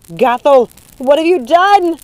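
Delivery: high, loud shriek of dismay; shrieked accusation; horrified shout. A narrator's reading. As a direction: cry out aghast at the damage. Mother horrified by the fire.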